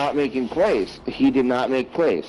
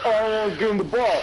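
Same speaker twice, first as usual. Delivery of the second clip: mocking impression; 8 semitones higher, faster than usual. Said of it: "how is Kyler Murray gonna be like".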